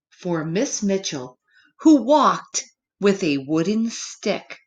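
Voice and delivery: plain reading voice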